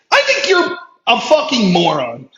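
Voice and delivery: ordinary talk, nothing marked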